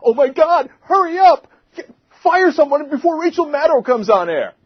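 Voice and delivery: high, loud mock panic; panicked politician. Host mocking a panicked politician, high frantic squeal. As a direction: squeal like a scared Democrat imagined as Republican. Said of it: "can you imagine a Republican saying".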